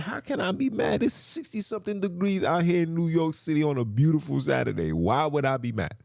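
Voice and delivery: high-pitched retold disbelief, settling toward the end; incredulous protest. Answering the brother who called him mad, incredulous. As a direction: protest your innocence, starting way up high.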